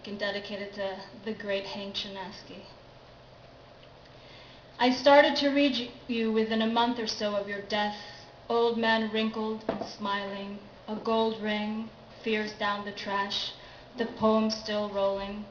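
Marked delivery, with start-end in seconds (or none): 4.8-15.4 louder, deliberate verse recital with pauses between phrases; poetry reading. Acted. Poet reads an early poem dedicated to Hank Chinaski, deliberate recital. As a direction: declaim it line by line, pausing after each image.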